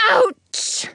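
high yelp of pain; sharp hurt yelp; cry of pain. Acted. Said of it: "he cries".

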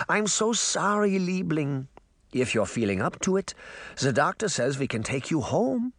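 put-on consoling old woman with a light German accent. Granny comforting Sabrina in hospital, light German accent. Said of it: "the old woman continued".

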